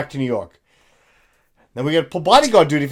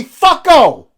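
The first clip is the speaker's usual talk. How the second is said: demonstrated shout; 8 semitones higher, 10 dB louder, slower than usual. Shown as a demonstration. Demonstrates cursing to clear corner.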